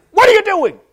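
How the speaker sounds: put-on loud, high angry yell at a kid; mean dad yell. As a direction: bark it like a furious dad. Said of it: "I yell".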